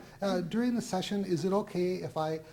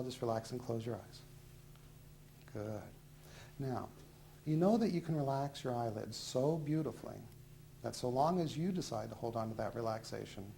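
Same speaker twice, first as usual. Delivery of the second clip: hypnotist induction; 4 semitones lower, 5 dB quieter than usual. Acted. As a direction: lull them down gently and steadily.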